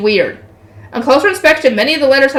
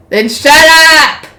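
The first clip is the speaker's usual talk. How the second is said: loud, drawn-out shout; angry order; 4 semitones higher, 6 dB louder, much slower than usual. Acted. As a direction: raise pitch and volume to silence him. Gangster snaps at grovelling character.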